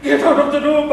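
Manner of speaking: imitated hysterical shouts; screaming. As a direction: scream at an intruder to get away. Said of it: "She kept on shouting, screaming".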